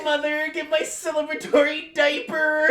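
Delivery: high, loud laughing whine; whiny baby voice. Pretend. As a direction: wail like a spoiled child. Mock baby wail.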